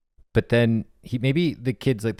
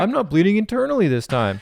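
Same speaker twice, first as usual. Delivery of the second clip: put-on goofy kid voice; 10 semitones higher, 4 dB louder than usual. Kid amazed, voiced high.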